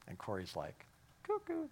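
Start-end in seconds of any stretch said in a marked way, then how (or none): 1.2-1.7 much higher, sing-song crazy call; mocking cuckoo. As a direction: tease her as if she's nuts. Corrie thinks Betsy crazy.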